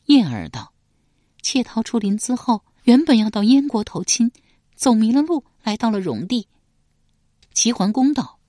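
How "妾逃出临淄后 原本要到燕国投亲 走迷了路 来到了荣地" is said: higher, louder maiden recounting; lightly bright girl's voice telling her flight; 少女声音讲述; higher young woman's voice. Acted. Yan'er's voice in dialogue.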